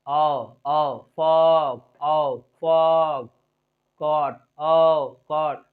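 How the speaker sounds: "ओ" low and long, then the same vowel in "फॉग", "कॉट"; very slow "फॉग", "कॉट" with held vowel; accented open vowel. Shown as a demonstration. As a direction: stretch "ओ" inside "फॉग" and "कॉट". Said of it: "मेरे accent पर ध्यान दीजिए".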